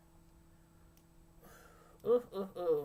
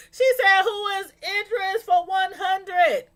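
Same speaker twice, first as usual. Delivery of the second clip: laughing; 9 semitones higher, 7 dB louder than usual. Real, not acted.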